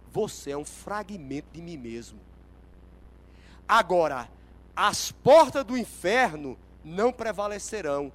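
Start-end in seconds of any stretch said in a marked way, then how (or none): 3.7-8.1 loud, emphatic declaration; forceful preaching. Real, not acted. Preacher declaring Jesus's promise to the church, forceful proclamation. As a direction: proclaim it as a victory over hell.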